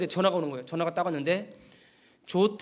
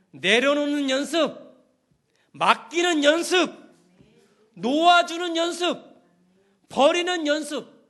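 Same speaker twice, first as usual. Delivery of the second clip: rising, louder, higher preaching list; emphatic preaching; 6 semitones higher, 4 dB louder than usual. Real, not acted. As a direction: build each phrase up with fervour. Listing practices, building up. (점점 고조되는 힘찬 설교)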